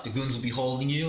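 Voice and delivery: ordinary delivery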